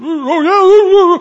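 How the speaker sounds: high, loud, drawn-out alarmed oh yeah; suddenly spooked, stretching the words; loud high dismay; startled gasp. Retold or imitated. Disciples realize Jesus is near.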